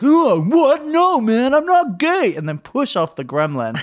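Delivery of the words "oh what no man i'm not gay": high, loud protest; mock straight-guy panic. Straight guy shoving off the gremlin, high mock panic. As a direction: yelp it like a flustered bro. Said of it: "he'd be like".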